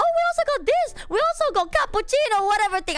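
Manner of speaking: high, loud, rushed gushing; mimicking breathless excited name-dropping; imitated eager seller. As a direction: rattle them off like an overeager vendor. Acts out eager name-dropping woman.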